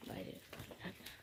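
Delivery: breathy whisper, barely voiced. Real, not acted. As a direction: say it under your breath. Repeated in whisper.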